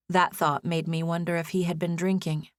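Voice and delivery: plain reading voice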